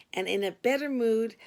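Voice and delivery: ordinary delivery, nothing marked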